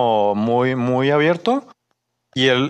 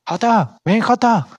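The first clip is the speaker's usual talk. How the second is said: high, sing-out summons; mock calling out; 10 semitones higher, slower than usual. Coworker summoning his friend over, imitated high calling out. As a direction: call a pal over in a high voice.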